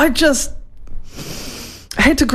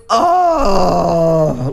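audible groan. Retold or imitated. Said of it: "like, audibly out loud".

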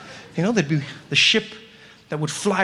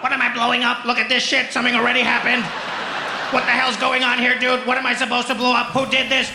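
higher, exasperated alien pilot; baffled alien; 4 semitones higher than usual. Retold or imitated. Alien baffled at ruined continent.

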